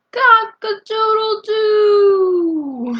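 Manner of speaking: big hammed-up cock-a-doodle-doo; rooster crow.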